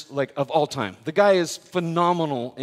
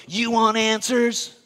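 over-the-top movie general; 5 semitones higher, 3 dB louder, slower than usual. Retold or imitated. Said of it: "comes back over the top and goes".

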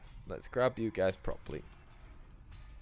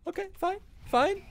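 mock sulk. Mock sulking.

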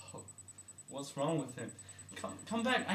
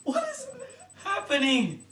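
high loud exasperated shout; exasperated yell; 5 semitones higher, 11 dB louder than usual. Real, not acted. Doctor yells high and loud at the job. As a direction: cry out at the job.

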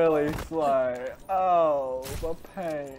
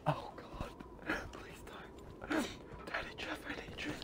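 mock pleading whisper; 6 semitones higher, 11 dB quieter than usual.

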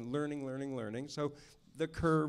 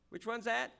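imitating director; 8 semitones higher than usual. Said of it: "and he'd go like".

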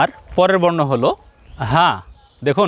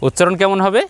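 ordinary talk

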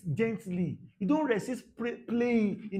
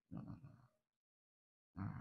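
slow breathy whisper; hushed whispered no; 12 semitones lower, 20 dB quieter, much slower than usual. Acted. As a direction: drop to a bare whisper. Hushed aside in sermon.

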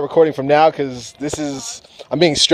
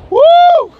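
high loud whoop of delight; joyful whoop; 9 dB louder than usual. Real, not acted. Flier to viewers, a high whooping cheer. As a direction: let out a big happy cheer.

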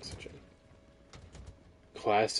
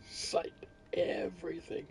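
whispering; 9 semitones higher, 3 dB quieter than usual. Pretend. Whispered emphasis after boast.

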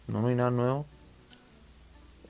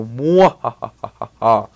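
slow, loud evil laugh; mustache twirling villain; 9 dB louder, much slower than usual. Pretend. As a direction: cackle like a cartoon villain. Gloating as the top youtuber.

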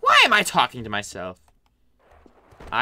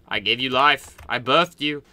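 normal talking voice

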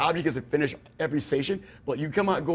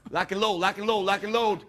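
high barked drill commands; barking gym coach; 5 semitones higher, slower than usual. Pretend. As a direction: drive the recruit through reps. Drill sergeant leads exercise.

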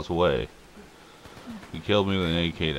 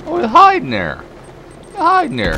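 much higher, loud excited callout; 10 dB louder than usual. Real, not acted. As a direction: shout out where they're hiding. Excited spotting enemies.

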